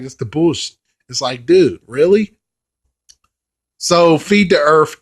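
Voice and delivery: ordinary talk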